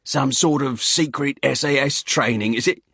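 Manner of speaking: pry with a loud Cockney grin; loud Cockney hiker. Acted. Hiker pesters the instructors in a loud Cockney voice.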